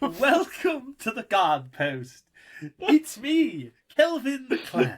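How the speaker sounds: very high, slow mock-posh English; upper English accent. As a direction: put on a silly, high upper-class English voice. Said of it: "it's supposed to be upper English".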